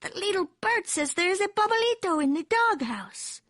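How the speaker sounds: high put-on kitten voice; excited kitten character. A narrator's reading. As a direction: announce the news as a little storybook kitten. Kitten announces news, put-on voice.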